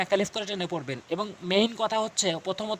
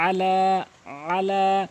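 "আলা" with short throaty first syllable and stretched "লা"; quick ain, long lam; 3 dB louder, much slower than usual. Shown as a demonstration. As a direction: clip the "আ", hold the "লা" in each "আলা". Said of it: "আইন দ্রুত পড়তে হবে". ("আলা" আইন দ্রুত, লাম টেনে)

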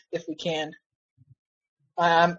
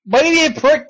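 higher, punchy jab with stress on be; snapping at the game; 7 semitones higher, 3 dB louder than usual. Real, not acted. Streamer telling off the game, sharp and raised. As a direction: throw the insult up at the screen.

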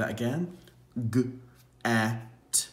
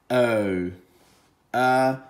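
ordinary delivery

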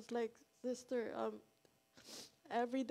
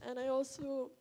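ordinary delivery

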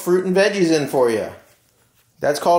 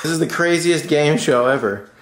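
ordinary talk

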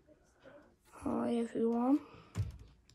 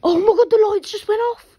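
higher, louder panicked cry; panicked shout; 9 semitones higher, 16 dB louder, faster than usual. Real, not acted. Player shocked when the lights cut, panicked shout. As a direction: yelp it in sudden fright.

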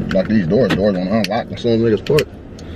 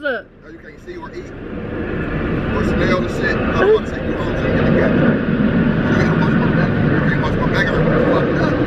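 1 mock voice. Mocking the friend covered in cake.

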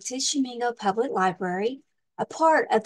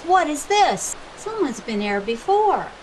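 alarmed pirate; 5 semitones higher, 4 dB louder than usual. Acted.

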